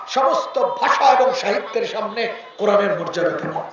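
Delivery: usual speaking voice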